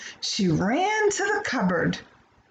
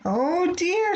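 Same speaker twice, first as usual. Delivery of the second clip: a sighing oh dear, higher; sighed; 8 semitones higher than usual. A narrator's reading. Mother sighing over Jenny's hats. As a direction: sigh it out. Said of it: "sighed her mother".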